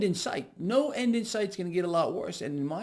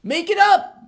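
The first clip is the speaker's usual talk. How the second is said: sudden high, very loud shout; big high-pitched mock holler; mock yell; 14 semitones higher, 13 dB louder than usual. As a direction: yell it out, hammed-up. Mock yell capping the parody.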